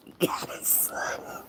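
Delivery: breathy teasing whisper. Real, not acted. Streamer whispering a cheeky nickname to a friend. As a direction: whisper it close like a private joke.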